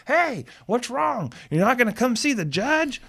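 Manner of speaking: high taunting call, not full volume; mock holler down a stairwell; retold yelling. Yelling down the stairwell at the attorney, high retold holler. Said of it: "I was yelling".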